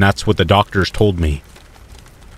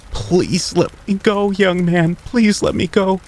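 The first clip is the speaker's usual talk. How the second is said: much higher, slow, frightened begging; worried plea; 11 semitones higher, slower than usual. A narrator's reading. Doctor begs the boy choking her, frightened high plea. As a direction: plead for your life, voice pitched way up. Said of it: "the lady said, sounding worried".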